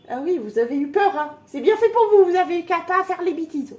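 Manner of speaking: high, loud playful telling-off; mock scolding. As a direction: scold them like naughty kids.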